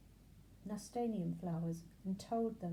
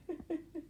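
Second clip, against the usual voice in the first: 1 mocking laugh; 9 semitones higher than usual. A narrator's reading. Grasshopper laughs at Benji.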